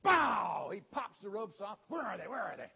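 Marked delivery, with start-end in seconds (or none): 0.0-0.7 very loud, high burst; booming sound-effect word; explosive bow. Acted. Sound effect of snapping ropes. 1.9-2.7 loud, urgent demand; acted strongman yell; battle-ready shout. Samson leaps up to fight.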